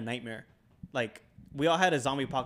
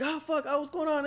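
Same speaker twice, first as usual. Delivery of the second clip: put-on scared kid; 13 semitones higher than usual. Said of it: "And I'm like".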